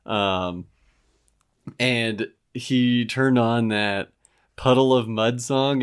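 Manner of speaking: ordinary talk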